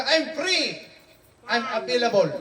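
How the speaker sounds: mas taas nga tingog, kunohay malipayon; gamay nga pagsundog sa biyuda nga libre na; mock malipayong biyuda. (kunohay nga malipayong pahayag sa biyuda)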